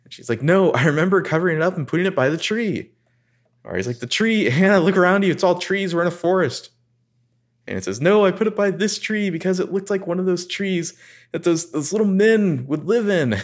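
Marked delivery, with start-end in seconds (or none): 0.4-2.9 higher, panicky retelling, a bit louder; mock freak-out. Recapping Hannah lost in the woods, mock panicked. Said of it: "Hannah's just having a freak out". 4.0-6.7 much higher, louder, exasperated pushback; exasperated Ari. Retold or imitated. Ari snapping at Hannah about the trees, exasperated high voice. 8.0-13.4 much higher, airy put-on girlish voice; ditzy Hannah voice. Hannah explaining her tree choice to Ari, ditzy high voice.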